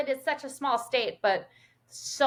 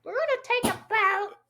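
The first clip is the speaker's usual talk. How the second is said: high-pitched Minnesotan with big round O's; squeaky cartoon Minnesota accent; exaggerated Minnesota accent; 11 semitones higher than usual. Shown as a demonstration. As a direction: ham up a Minnesota "boat", high and silly. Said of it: "make fun of us for our O's".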